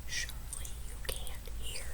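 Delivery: hushed whisper aside. Real, not acted. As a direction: breathe it so no one hears. Reader whispering aside to children.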